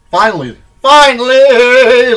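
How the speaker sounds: high, loud, drawn-out shout; triumphant cry. Acted. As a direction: belt it out, stretching the word. Mock triumph at hair bands' end.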